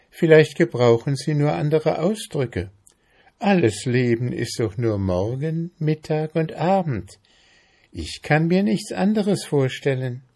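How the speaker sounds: lightly voiced innocent mayfly; artless mayfly. A narrator's reading. Mayfly explaining life to the ant, artless.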